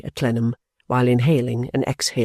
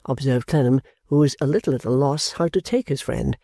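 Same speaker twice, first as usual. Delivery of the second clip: usual speaking voice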